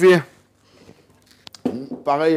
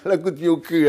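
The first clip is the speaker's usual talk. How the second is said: laughing; 2 semitones higher than usual. Real, not acted. Laughs repeating his own blunder.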